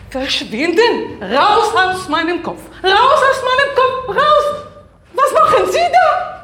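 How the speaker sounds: loud, high screaming with laughter; frantic yelling. Acted. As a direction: shout the spirit out of your head. Besessene schreit gegen Geist. (hysterisch schreiend)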